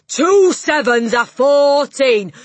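slow, high, furious bellow; bellowing Trunchbull. A narrator's reading. Headmistress drilling the dangling boy, furious bellow. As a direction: roar it out very slowly. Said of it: "Miss Trunchbull bellowed".

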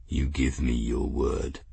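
deep, quiet cat growl; low-pitched, guarded clan chief. A narrator's reading. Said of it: "Norn growled finally".

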